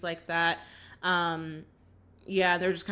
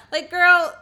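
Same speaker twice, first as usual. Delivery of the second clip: loud, raised voice: go be home; indignant retort; 7 semitones higher, 6 dB louder than usual. Real, not acted. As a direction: fel uitvallen tegen een zus. Angry retort at Angela.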